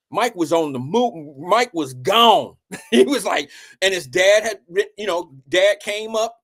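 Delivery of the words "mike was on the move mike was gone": slow dramatic. Acted. Dramatic reveal of Jordan vanishing.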